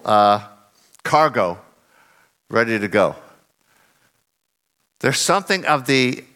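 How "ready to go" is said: ordinary delivery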